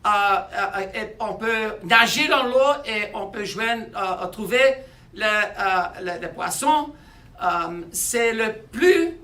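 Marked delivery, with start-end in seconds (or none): none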